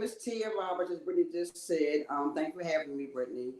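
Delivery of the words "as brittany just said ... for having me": ordinary talk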